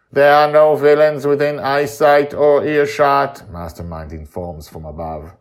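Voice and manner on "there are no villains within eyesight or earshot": loud, even, formal report; lightly voiced machine report; robotic watcher. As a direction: announce it like a sentinel. Mastermind reports from above.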